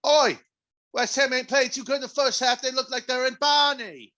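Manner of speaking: high hammed-up Cockney commentary; mock Cockney. Judging West Ham in mock Cockney. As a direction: moan like a London pub fan.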